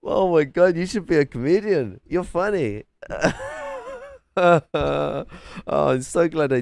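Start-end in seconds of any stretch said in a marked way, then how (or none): 0.1-2.8 higher, louder mock praise through laughter; sarcastic amusement. Real, not acted. Streamer sarcastically praising a debater, amused and higher. 2.9-4.1 a short high squeal of laughter; high giggle. Real, not acted. Streamer giggling at chat, high laugh.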